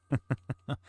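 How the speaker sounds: brief burst of laughter; short laugh. Real, not acted. Streamer amused by own remark, short laugh. Chuckles after remark on looks.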